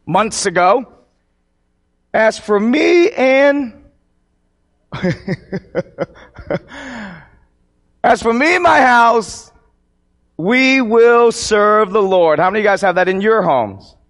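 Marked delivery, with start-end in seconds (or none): 2.1-12.4 slow, stretched-out verse recitation; declaimed scripture. Acted. Preacher quoting a household verse to his congregation, slow proclamation. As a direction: proclaim it with long held words.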